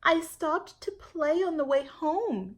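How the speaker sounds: put-on high, slow child's voice; a small girl explaining herself; little girl voice. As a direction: play young Jamaica answering her mother. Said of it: "Jamaica said".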